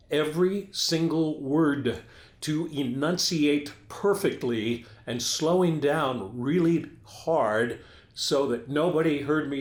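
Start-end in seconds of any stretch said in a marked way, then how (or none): 0.1-7.8 slow down and land each word separately; deliberate, painstakingly articulated delivery; slow, over-careful enunciation. Shown as a demonstration. Anchor explaining how he hid drinking on air, slow and over-careful. Demonstrates careful slowed-down speech.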